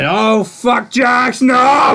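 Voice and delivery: high, loud hammed-up panic; mock panicked shout. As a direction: play at pleading in a high-pitched yell. Acting out Luke at gunpoint.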